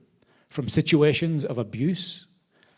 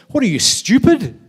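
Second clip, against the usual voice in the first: much higher, louder exasperated outburst; man in the hole scolding the friend who jumped in; incredulous; 8 semitones higher, 9 dB louder than usual. Retold or imitated. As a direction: scold him for jumping in. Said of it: "The guy looks and says".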